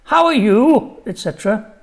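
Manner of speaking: high, loud polite grown-up. Retold or imitated. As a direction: chirp it like a fussy adult. Grown-ups' stock phrase mimicked.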